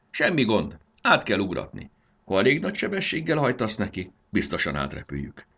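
loud, eager instructions; commanding. A narrator's reading. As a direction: take charge like a confident co-driver. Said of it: "vezényelt".

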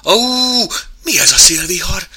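high yelp into alarmed question; frightened question. Acted. Mouse is hit by sudden wind, frightened. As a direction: cry out, startled, high and loud. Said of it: "kérdezte ijedten".

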